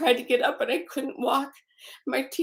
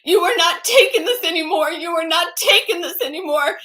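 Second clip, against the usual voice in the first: louder, higher firm outburst; retold outburst; 4 semitones higher, 6 dB louder than usual. Sister finding her beaten, retold loud outburst. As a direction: sister putting her foot down. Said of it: "and she said".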